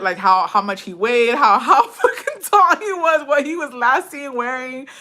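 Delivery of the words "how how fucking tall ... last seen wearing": high, loud, slowed by laughter; laughing while talking. Real, not acted. Listing missing-flyer details, laughing through the words. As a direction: struggle through the list, cracking up.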